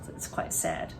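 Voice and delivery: whispered, soft and low; whispered aside. Real, not acted. Teller whispering to the listening children, soft and low.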